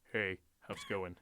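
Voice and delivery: low imitated man's greeting; deep-voiced store clerk. Male clerk greeting him at the register, low imitation. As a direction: drop your voice for a casual manly hello. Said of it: "it's like fucking James Earl Jones".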